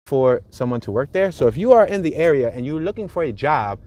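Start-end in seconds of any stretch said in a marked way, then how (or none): none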